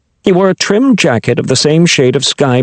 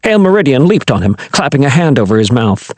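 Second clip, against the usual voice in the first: plain reading voice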